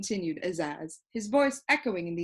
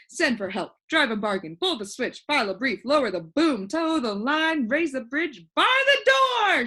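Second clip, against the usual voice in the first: rattling off, rising to a high loud shout; building shout; 6 semitones higher, 5 dB louder than usual. A narrator's reading. Bug jumping and waving his arms, building to a shout. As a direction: pile them up louder until the last bursts out. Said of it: "shouted the bug".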